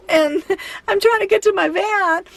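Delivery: ordinary delivery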